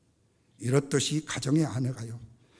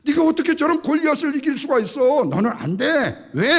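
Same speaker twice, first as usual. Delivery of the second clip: high, loud dismissal; raised voice of a disbelieving king; Saul; 9 semitones higher, 10 dB louder than usual. Acted. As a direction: forcefully rule the boy out. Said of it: "사울 왕마저도 하는 얘기가".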